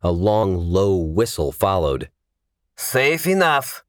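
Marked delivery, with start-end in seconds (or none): 2.8-3.8 high, slow call with a touch of gruffness; gruff call. A narrator's reading. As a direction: lightly act a gruff man calling out. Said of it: "Victor called, his voice gruff".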